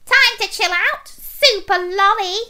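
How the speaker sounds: high, loud gloating taunt; wicked mocking pea voice; cackling evil pea. A narrator's reading. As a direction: gloat in a squeaky villain cackle. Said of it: "cackled the Evil P".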